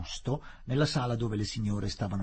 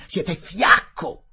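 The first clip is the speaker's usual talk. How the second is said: contemptuous insult; 8 semitones higher, 11 dB louder, much slower than usual. Acted. Collector calls Fielding weak.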